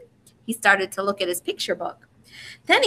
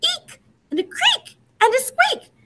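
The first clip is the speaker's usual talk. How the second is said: very high, squeaking every word; squeaky mouse voice. Shown as a demonstration. Reader leading children to repeat, even higher and squeakier. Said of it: "Can you say it?".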